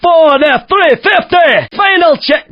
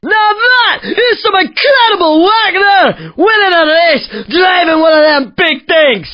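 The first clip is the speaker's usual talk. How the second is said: excited Scottish cheer; 5 semitones higher than usual. Acted. Race host cheering the winner in a Scottish accent. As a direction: whoop it up with a Scottish lilt.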